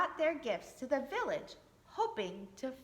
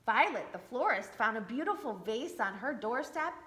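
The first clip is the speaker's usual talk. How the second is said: ordinary delivery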